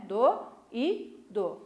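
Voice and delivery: "Do e do" as three slow beats, the middle an isolated "i" sound; split into syllables. Shown as a demonstration. Teacher showing the hiatus to pupils, "Do e do" syllable by syllable. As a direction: space out "Do e do" as do, í, do. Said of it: "no doído separou".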